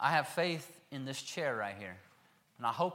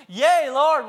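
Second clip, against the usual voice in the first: Yea, Lord shouted high and loud; eager exclaim; 9 semitones higher, 14 dB louder than usual. Shown as a demonstration. Blind men answering Jesus, high and loud. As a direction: burst out Yea, Lord with joy. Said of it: "I don't think they said it like that either".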